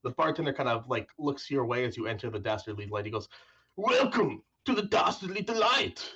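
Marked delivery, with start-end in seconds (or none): 3.8-6.1 high, loud, slow bartender's call; big stretched-out tavern greeting; yelled welcome. Acted. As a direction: call it out over a noisy crowd. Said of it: "he yells over a large group".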